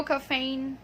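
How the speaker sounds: "caffeine" as kuh-FAYN, like a customer ordering; "caffeine" with a long A, not long E; imitated mispronunciation. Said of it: "I hear a lot of people like".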